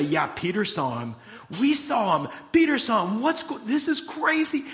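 imitating astonished, high-pitched chatter; hammed-up breathless amazement of several people; acted excitement. Apostles excitedly swap news of sightings.

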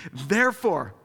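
ordinary delivery

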